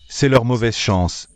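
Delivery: normal talking voice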